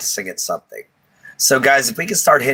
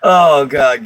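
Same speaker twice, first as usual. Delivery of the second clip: ordinary talk, nothing marked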